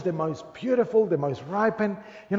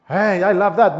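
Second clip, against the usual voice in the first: normal talking voice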